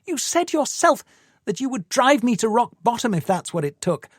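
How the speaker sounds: high, rising accusation; accusing woman. Acted. Tamsin confronting Paul.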